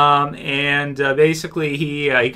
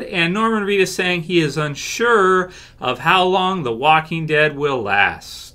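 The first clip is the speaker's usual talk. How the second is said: usual speaking voice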